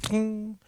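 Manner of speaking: high bright ping like a notification bell. Pretend. Imitating notification bell sound.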